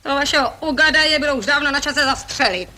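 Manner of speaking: high-pitched furious comeback; angry retort. Acted. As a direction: snap back at him, voice up high. Angry retort after shouting.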